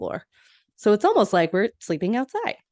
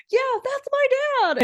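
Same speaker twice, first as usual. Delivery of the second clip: very high-pitched freak-out; super excited shouting; 4 dB louder than usual. Retold or imitated. Recapping Phoebe spotting her dad's picture, imitated excited shriek. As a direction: squeal it out, imitating someone wildly excited. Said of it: "she's freaking out and shouting and getting super excited".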